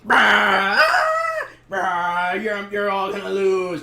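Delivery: loud roaring puppet monster; mock monster threat. [name]'s sock puppet as the cluster, mock monster threat. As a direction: roar and drag out the threat.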